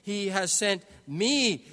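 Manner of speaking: slow line with "me" long and loud; stress on me. Shown as a demonstration. Preacher showing that Jesus points to himself, stress on "me". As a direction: land heavily on the word "me". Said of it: "are pointing to himself".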